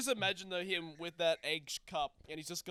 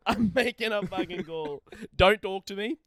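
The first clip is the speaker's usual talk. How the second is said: louder, irritated, cutting them off; loud and fed up; 4 semitones higher, 10 dB louder than usual. Real, not acted. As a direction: shut everyone up, annoyed. Annoyed, busy on the phone.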